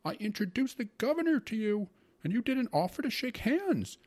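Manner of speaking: loud, high-pitched scolding rant; indignant grumbling uncle. A narrator's reading. Uncle berates his sister over the governor's dinner, indignant grumbling. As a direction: scold in a high, exasperated voice, stretching key words. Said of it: "my uncle grumbled, wrinkling up his face".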